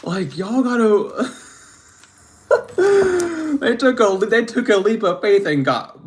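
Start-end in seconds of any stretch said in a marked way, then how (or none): 2.5-3.5 a sudden high, loud cackle; high burst of laughter. Real, not acted. Laughing to viewers at a spirit-led story, a high loud cackle.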